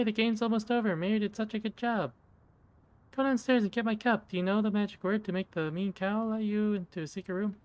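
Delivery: put-on high, sweet little girl doll voice; a doll's squeaky childlike voice; creepy talking doll. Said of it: "the mouth begins to move".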